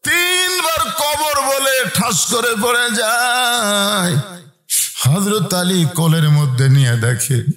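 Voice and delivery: impassioned sing-song preaching; melodic sermon storytelling. A narrator's reading. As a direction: tell it as a chanting preacher, stretching line endings. Preacher tells story in chant.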